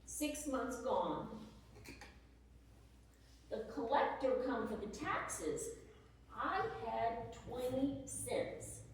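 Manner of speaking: weary despair. Acted. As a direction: let each word sink, exhausted and near empty. Wife's weary letter, money gone.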